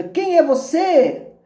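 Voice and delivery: high, drawn-out calling question; curious boy calling out slowly, pitched high. A narrator's reading. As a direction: shout the question out to the mountains. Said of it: "Curioso, ele pergunta".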